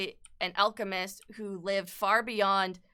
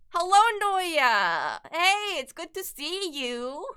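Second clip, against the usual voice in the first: cheerful greeting; 8 semitones higher, 5 dB louder, slower than usual. Real, not acted. Greeting a viewer warmly.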